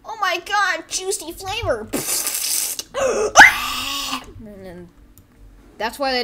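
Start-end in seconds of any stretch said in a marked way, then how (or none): 0.0-1.9 high, loud over-the-top ad excitement; commercial parody. Acted. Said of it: "Airhead commercials. This is what they do". 1.9-4.3 high, loud mock gasp; loud gasping scream. Acting out the commercial's mishap.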